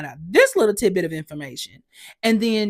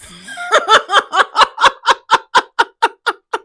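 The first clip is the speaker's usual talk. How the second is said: high, loud rhythmic laugh; long peal of staccato laughter; burst of laughter; 9 dB louder than usual. Real, not acted. Bursts out laughing after plea.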